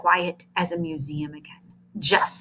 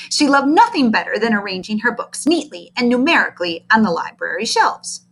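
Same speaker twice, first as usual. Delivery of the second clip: ordinary delivery, nothing marked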